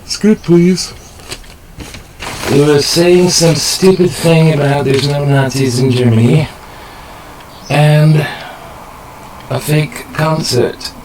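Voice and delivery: pretend director. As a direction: snap at the actor like an impatient director. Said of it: "my own little movie production studio".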